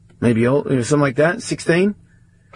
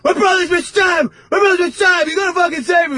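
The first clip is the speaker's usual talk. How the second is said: panicked shout. Retold or imitated. Teen bursting into the clinic, screaming his brother's stabbed. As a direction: scream for help as you burst in.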